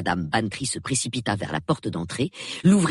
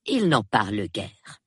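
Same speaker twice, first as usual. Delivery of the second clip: usual speaking voice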